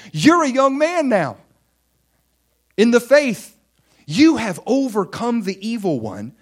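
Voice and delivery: high, loud proclamation as God; the Lord proclaiming loudly; booming voice of the Lord. Acted. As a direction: declare it with full force over them. Lord speaking in sermon.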